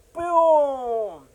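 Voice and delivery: loud boom. Real, not acted. Sudden collapse of savings.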